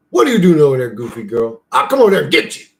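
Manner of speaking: loud playful threat to the dog; loud mock threat to a boxer; playful dog call. Real, not acted.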